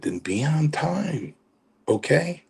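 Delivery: slow, high-pitched mock scolding; Chief. Chief telling the latecomer off, imitated slow high voice. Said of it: "He said".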